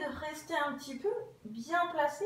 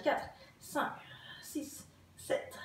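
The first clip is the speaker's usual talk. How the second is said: whispered count; 4 dB quieter, slower than usual. Real, not acted. Counting while holding the exercise.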